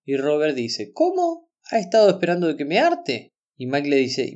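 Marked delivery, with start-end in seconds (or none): 0.9-3.3 incredulous question, leaping up high at the start; mock astonishment on a high-pitched question; retold surprise. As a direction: act out a taken-aback boy. Robert reacts to unexpected news.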